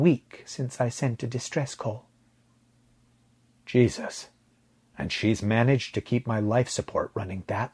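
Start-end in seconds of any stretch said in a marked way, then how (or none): none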